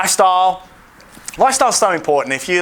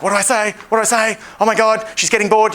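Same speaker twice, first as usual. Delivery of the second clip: 1 mock panic; 7 semitones higher than usual. Mocking panicked guy's inner voice.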